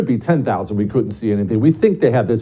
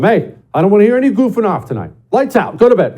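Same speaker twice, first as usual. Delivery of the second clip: quick, higher and louder parent command; stern bedtime warning; 10 semitones higher, 5 dB louder, faster than usual. Retold or imitated. Dad warning his sons against goofing off, quick loud order. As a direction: lay down the law fast, like a dad at the bedroom door.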